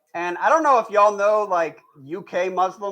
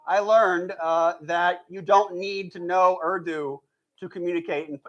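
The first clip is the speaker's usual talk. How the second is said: ordinary delivery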